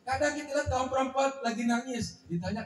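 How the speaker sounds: ordinary delivery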